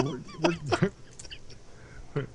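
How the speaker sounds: breathy whisper into one ear; whisper in left ear. Real, not acted. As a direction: whisper close, right at the ear. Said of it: "somebody whispers into your ear".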